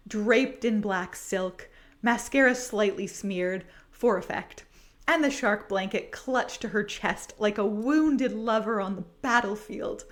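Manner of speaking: ordinary talk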